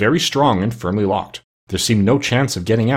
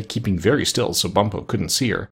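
usual speaking voice

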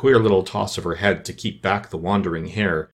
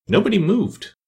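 ordinary delivery, nothing marked

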